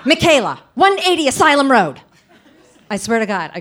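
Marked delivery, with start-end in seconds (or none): 0.0-2.0 loud panicked yell of names and address; frantic aunt hollering the kids' address. Retold or imitated. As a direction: shout it like a freaked-out aunt. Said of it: "all she can do is yell out our addresses".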